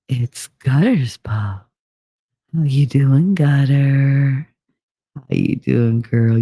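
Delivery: low, drawn-out mock come-on; playful greeting bit. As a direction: tease a friend in a put-on low voice. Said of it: "I had to do that for her".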